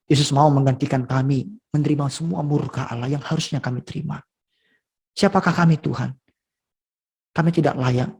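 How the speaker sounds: earnest spoken prayer to God; closing prayer. Real, not acted. Preacher leads the congregation in prayer, humble and earnest. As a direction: pray humbly before the congregation.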